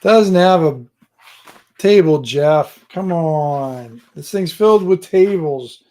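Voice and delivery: loud, drawn-out playful complaint; mock exasperation. Host ribbing a viewer for picking a tableless page, mock exasperated. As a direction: scold them loudly in jest.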